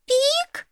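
very high animal cry; squirrel's shrill squeak. A narrator's reading. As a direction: squeak like a frightened ground squirrel. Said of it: "крикнула еврашка".

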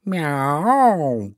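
long swooping miaow; greedy cat meow. A narrator's reading. Cat savours promised meal, meowing greedily. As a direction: draw out a meow, licking lips. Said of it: "кот Васька облизнулся".

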